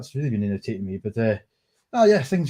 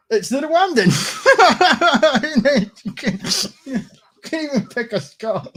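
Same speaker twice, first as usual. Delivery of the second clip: laughing in disbelief, pitched up high and loud; incredulous laugh; 13 semitones higher, 6 dB louder than usual. Real, not acted. Streamer laughing at a tweet to viewers, high incredulous laughter. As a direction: crack up at the absurd pick. Mocking a tweet's Rwandan pick.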